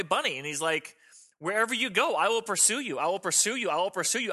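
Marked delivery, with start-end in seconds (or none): 1.4-4.3 raised pitch, driving repetition; insistent vow of God. Acted. Preacher voices God pursuing the runaway sinner, higher and insistent. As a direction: hammer the promise with conviction, pitched up. God's vow, like mother bunny.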